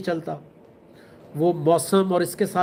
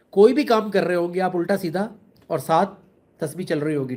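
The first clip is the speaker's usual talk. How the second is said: ordinary talk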